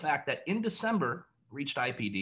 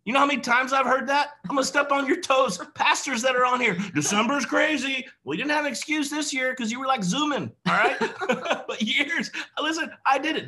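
fired-up calling out; 8 semitones higher, 5 dB louder than usual. Real, not acted.

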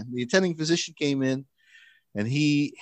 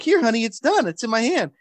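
reassuring parent; 11 semitones higher, 4 dB louder than usual. Retold or imitated.

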